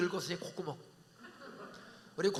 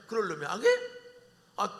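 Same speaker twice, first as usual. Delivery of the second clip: ordinary talk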